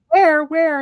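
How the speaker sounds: high, loud eager questions; excited where. Retold or imitated. Mother answering son about ghosts in TV, excited high shout. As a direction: jump up to see it now. Rushing to see family in TV.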